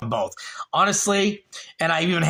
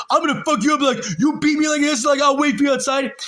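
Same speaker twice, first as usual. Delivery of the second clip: much higher, yelled comeback; shouting back; 9 semitones higher, 3 dB louder than usual. Retold or imitated. Narrator yelling back at the old actor, heated shout. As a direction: throw the threat right back at him. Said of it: "I'm like".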